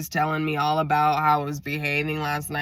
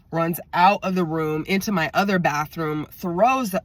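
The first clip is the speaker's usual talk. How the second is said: ordinary talk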